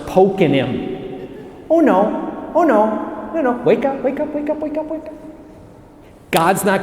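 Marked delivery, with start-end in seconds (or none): 1.7-5.1 pestering angel. Acted. Angel poking sleeping Elijah awake, playful high pestering. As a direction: poke at a sleeper in a tiny high voice.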